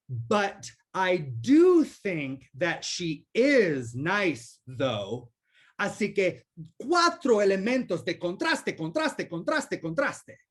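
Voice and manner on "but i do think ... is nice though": slow, drawn-out English sentence with contrastive stress on nice; contrastive English example. Shown as a demonstration. Linguist demonstrating contrast in English, slow stressed read. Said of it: "para crear un contraste en inglés".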